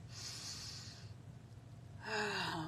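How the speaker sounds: long audible sigh out; long exhale. Shown as a demonstration. Showing the group a deep breath, long audible exhale. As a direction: let the breath out slowly and fully. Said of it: "Deep breath".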